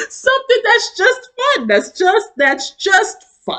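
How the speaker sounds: high, pleading whine; whiny longing. Retold or imitated. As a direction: beg for something fun. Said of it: "I'm just like".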